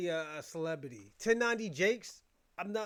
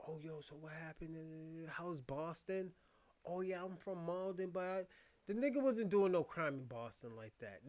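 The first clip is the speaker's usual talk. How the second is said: much softer, lower mock interviewee; 3 semitones lower, 8 dB quieter than usual. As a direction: mumble it like the guy being interviewed. Mock exchange voicing Jakes' interview.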